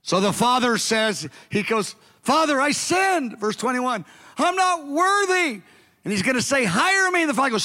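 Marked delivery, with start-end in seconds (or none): 2.2-3.4 slow, high penitent plea; pitched-up humble confession; pleading son. Retold or imitated. Son voiced pleading to father. 4.4-5.6 slow, high penitent plea; pitched-up humble confession; pleading son. Retold or imitated. 6.6-7.2 high, pushed plea; high desperate request; begging son. Retold or imitated.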